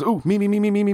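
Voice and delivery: high, rapid eager pleading to be picked; mock overexcited schoolkid squeal; eager kid. Joke teller voices a kid begging to answer, eager.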